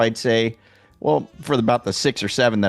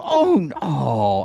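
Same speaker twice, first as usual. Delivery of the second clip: awkward oh; 5 semitones higher, much slower than usual. Retold or imitated. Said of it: "and they go".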